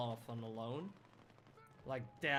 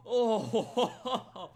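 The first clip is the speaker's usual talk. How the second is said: laughing; 8 semitones higher, 11 dB louder, slower than usual. Real, not acted. Laughs during game action.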